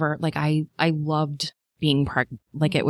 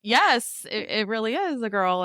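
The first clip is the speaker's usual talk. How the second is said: mock stunned reply; 7 semitones higher than usual. Quoting own stunned reply.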